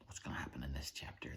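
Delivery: quiet unvoiced fast whisper; whispered aside. Real, not acted. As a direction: whisper your guess to yourself. Reader aside before chapter.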